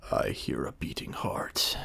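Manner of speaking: hungry unvoiced wolf whisper. A narrator's reading. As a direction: breathe it low like a predator.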